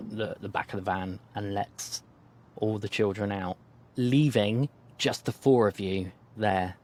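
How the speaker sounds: usual speaking voice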